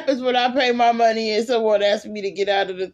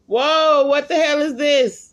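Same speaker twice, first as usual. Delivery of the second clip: loud scared yell; 6 semitones higher, 3 dB louder than usual. Acted. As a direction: shout it at the ghost. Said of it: "Why are you yelling?".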